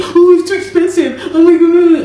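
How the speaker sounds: mock his moaning about the price; whiny complaining manager. Mocking her manager's constant complaints about the hotel cost, high whiny voice. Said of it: "He was always complaining".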